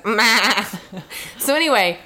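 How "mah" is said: short imitated sheep noise; sheep bleat. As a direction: bleat like someone in a sheep suit. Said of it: "he goes".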